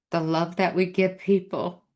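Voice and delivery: usual speaking voice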